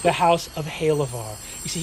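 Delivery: ordinary delivery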